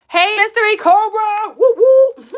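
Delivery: loud, high excited greeting; excited shout. Real, not acted. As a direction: call out to a fan excitedly.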